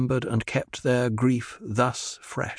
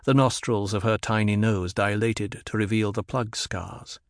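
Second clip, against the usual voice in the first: ordinary delivery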